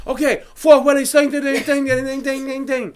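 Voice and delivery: high, gabbled mock order-calling; hammed-up unintelligible chef; garbled chef orders. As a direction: rattle off orders as nonsense. Imitating chef's garbled rapid orders.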